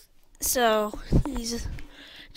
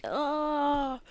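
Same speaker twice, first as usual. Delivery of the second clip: high, drawn-out "oh"; acted wail of the dying; dying groan; 5 semitones higher than usual. Everyone is dying.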